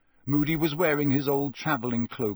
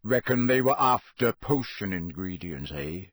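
Moody; 4 semitones lower than usual. A narrator's reading. Moody voiced.

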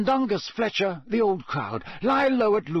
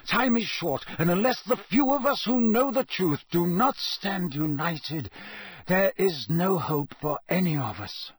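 bite of impatience; 6 semitones higher than usual. A narrator's reading. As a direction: let impatience sharpen the words. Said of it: "with a bite of impatience".

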